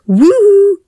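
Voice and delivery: high, loud sung-out "woo"; calling woo. A narrator's reading. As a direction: call the kids in from the porch. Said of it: "Mother called out using her".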